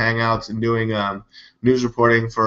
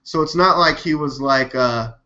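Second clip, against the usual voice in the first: ordinary delivery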